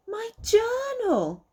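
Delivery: high, loud, slow delighted cry; overjoyed to see it back; joyful exclamation. Acted. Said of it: "exclaimed Peter".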